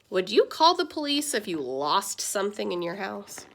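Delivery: plain reading voice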